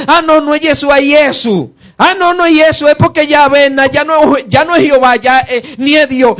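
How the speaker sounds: high-pitched, mocking string of self-corrections; confused student. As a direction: imitate a muddled learner changing his mind, pitched high. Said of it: "sigue profundizando y dice".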